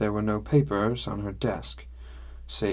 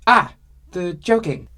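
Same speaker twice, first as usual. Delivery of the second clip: sudden loud, delighted outburst; loud exclamation; 9 semitones higher, 10 dB louder than usual. Acted. Sumi catching the narrator's joke, loud exclamation. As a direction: blurt it out loud enough to startle. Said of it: "she exclaimed so loudly that I jumped".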